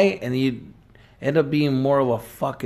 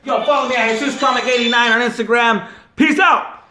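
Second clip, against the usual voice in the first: hyped sign-off; 10 semitones higher than usual. Acted. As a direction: pump up the listeners as you sign off. Podcast sign-off plug.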